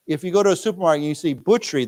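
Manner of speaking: ordinary talk, nothing marked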